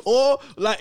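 ordinary delivery

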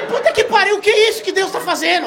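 high, loud laughing exasperation; exasperated. Real, not acted. Cursing his luck at dinner, high loud exasperation. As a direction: cry out in disbelief at fate.

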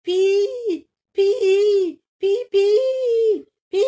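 very high, loud peeping; protesting chick. Acted. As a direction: cheep like an angry chick inside the egg. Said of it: "le poussin proteste". (le poussin proteste dans l'œuf, piaillant très aigu)

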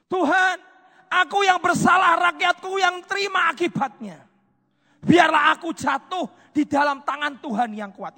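high, loud anguished plea; pleading prayer. Acted. King David pleading with God for his people, anguished. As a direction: cry out to God in guilt.

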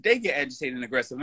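ordinary talk, nothing marked

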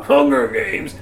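high, drawn-out mock title cry; mock dramatic cry. Podcaster mocking a copycat franchise, hammed-up title cry.

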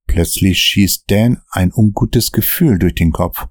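usual speaking voice

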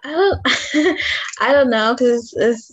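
ordinary talk

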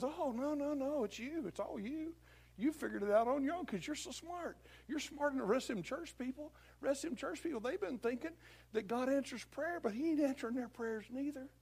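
high, soft, buttering-up voice; flattering devil. Acted. Devil crediting a believer for the doubt, high and flattering. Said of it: "And the devil says".

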